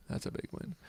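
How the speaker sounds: breathy whisper of delight. Real, not acted. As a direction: whisper the win to yourself. After big win.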